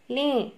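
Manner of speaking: लें said with a nasalised final vowel, lẽ; लें with nasal e; nasal ending. Shown as a demonstration. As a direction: sound लें through the nose at the end. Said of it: "एक की मात्रा के बाद यहाँ एक बिंदु है".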